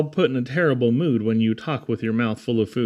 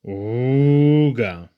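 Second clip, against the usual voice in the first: honking ooga. Acted. The squeezed bagpipe answering the turtle with a honking ooga.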